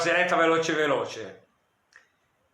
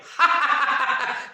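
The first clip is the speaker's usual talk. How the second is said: loud, high burst of laughter; hearty laugh; 12 semitones higher, 4 dB louder than usual. Real, not acted. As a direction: laugh out loud at your own punchline. Laughs at own joke.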